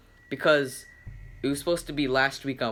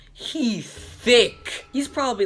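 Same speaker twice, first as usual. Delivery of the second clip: exaggerated emphasis; 10 semitones higher, 5 dB louder, slower than usual. Real, not acted. Exaggerated description of new kid.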